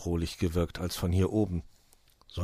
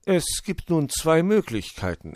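leicht angedeutete Uhustimme, etwas höher; erklär es bedächtig als Uhu; erklärender Uhu; 8 semitones higher, 4 dB louder than usual. A narrator's reading. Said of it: "erklärte der Uhu". (ein wenig belehrender Uhu)

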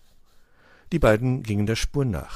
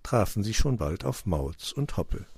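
ordinary delivery, nothing marked

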